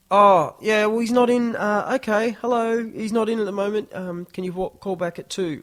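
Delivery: high, loud, flustered brush-off; busy pub worker. Retold or imitated. Pub worker fobbing off the band on the phone, high and flustered. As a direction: fob the caller off in a high hurried voice.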